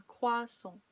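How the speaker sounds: croissant as kwa-SOH, French nasal ending; croissant, crisp and French, kwa-sahn; French pronunciation. Shown as a demonstration. Correcting English speakers on croissant, French way. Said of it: "it's just the pronunciation".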